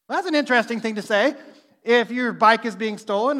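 normal talking voice

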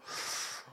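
sharp soft intake of breath at a great taste; a little savoring gasp; delighted gasp. Retold or imitated. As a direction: gasp as if the flavor just hit you. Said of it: "that you just go".

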